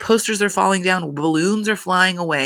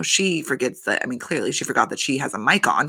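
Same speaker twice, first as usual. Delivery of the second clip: ordinary talk, nothing marked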